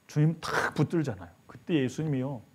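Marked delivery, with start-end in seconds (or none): none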